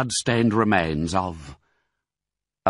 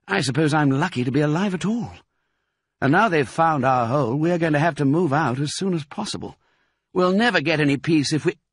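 quick, higher-pitched worried musing; worried Mister Fox; 7 semitones higher, faster than usual. A narrator's reading. Mister Fox fretting over his found hole, uneasy. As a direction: think aloud briskly, pitched up. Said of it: "he thought".

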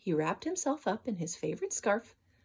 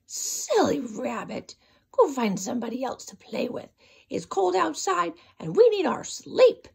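louder, cross squirrel voice; grumpy squirrel scolding; 4 semitones higher, 6 dB louder than usual. Acted. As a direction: shoo the rabbit away crossly. Squirrel scolds Leo off the tree.

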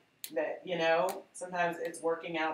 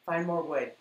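normal talking voice

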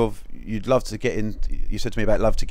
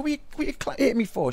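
imitating a startled, much higher protest; mock outrage, pitched way up; faster than usual. Retelling the footballer woken by his wife, startled and high. Startled husband hit in bed.

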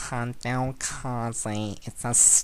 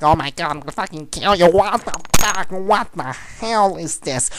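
loud, high-pitched gamer rage; outraged yelling; 6 semitones higher, 7 dB louder than usual. Real, not acted. Gamer cursing as the elevator fails, loud high outrage. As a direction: yell in exasperated disbelief, pitch climbing. Raging at broken elevator and opponent.

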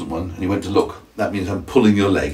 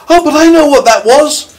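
put-on very high, loud boyish outburst; excited cry; 10 dB louder than usual. James interrupting the centipede, crying out high and loud. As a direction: burst in with sudden recognition. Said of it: "cried James".

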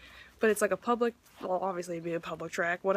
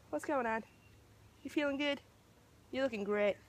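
ordinary talk

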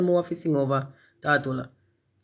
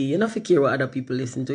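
normal talking voice

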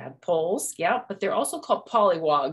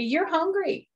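high frog voice; 13 semitones higher than usual. Acted. Froggy to baby.

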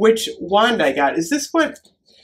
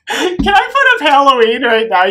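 higher, laughing through the question; giddy laughing plea; 5 semitones higher, 4 dB louder than usual. Real, not acted. Vlogger asking to put up Halloween right now, giddy. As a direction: plead it through giggles.

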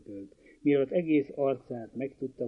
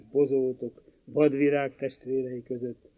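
plain reading voice

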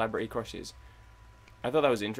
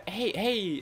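put-on British accent; 9 semitones higher than usual. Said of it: "start my accents with hey".

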